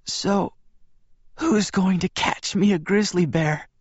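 high, frail joking whisper; faint whisper. A narrator's reading. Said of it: "Bella whispered".

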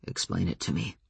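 low and very soft; quiet, dangerous calm. A narrator's reading. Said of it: "His voice was very soft".

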